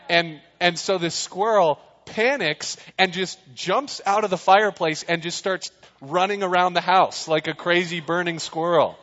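ordinary delivery

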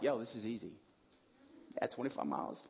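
lightly acted nonchalance, low and soft; imagined marathon runner. Runner shrugging off twenty-five miles, mock-casual. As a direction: toss it off quietly, mock-casual. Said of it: "marathon runner is like".

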